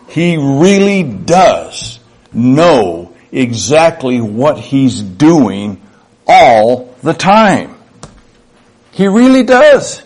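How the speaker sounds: REALLY and DOES drawn out slow and emphatic; slow, insistent conviction on each word. Real, not acted. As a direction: drive home "He really does" with slow, weighty stress. Emphatic assurance of faith.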